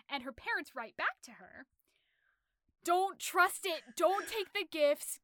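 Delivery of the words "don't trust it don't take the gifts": higher, louder and slow, each order hammered; urgent warning. Retold or imitated. Parents warning their daughter to get away, loud and slow. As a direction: lay down the law to a daughter in danger.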